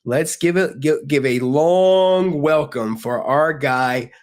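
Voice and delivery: slow, hyped announcer call; announcer intro. Acted. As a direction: bring him on like a ring announcer. Introducing guest to chat.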